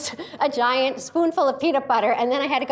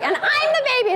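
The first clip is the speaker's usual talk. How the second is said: put-on whiny toddler insisting; little kid; 13 semitones higher than usual. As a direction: toddler insisting in a high whine. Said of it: "And it's like".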